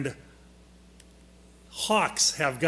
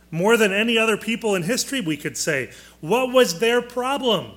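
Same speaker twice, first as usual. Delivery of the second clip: rising, louder, urgent ask; emphatic question; 5 semitones higher, 4 dB louder than usual. Real, not acted. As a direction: push the class for the answer. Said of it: "teacher presses the question".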